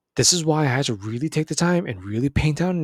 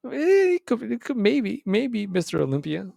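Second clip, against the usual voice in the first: teasing hedge; 8 semitones higher than usual. Acted. Teasing hedge about NBA dream.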